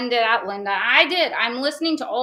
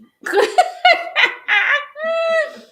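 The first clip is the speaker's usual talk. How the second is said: big laugh; 6 dB louder than usual. Real, not acted. Laughs at her own joke.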